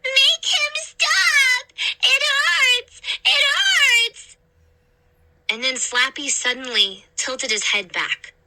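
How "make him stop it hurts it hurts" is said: put-on high, slow sobbing child's plea; a toddler sobbing in pain; high child's voice crying; crying little girl.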